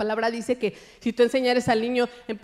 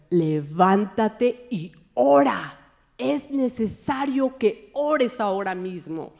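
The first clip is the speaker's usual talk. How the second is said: slow, measured command; voice of the Spirit; 2 semitones lower, much slower than usual. Retold or imitated. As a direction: command it slowly, word by word, as the Spirit. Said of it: "Y el Espíritu me decía".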